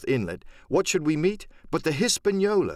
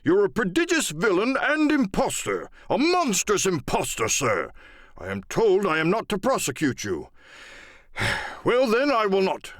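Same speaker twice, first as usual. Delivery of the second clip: indignant squire; 7 semitones higher than usual. Acted. Squire denouncing Silver to his face, indignant. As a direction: spit out contempt with dignity.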